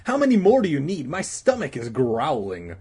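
quick, high opening complaint; hungry kid griping at his friend; complaining. Acted. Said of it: "Danny complained".

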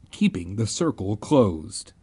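plain reading voice

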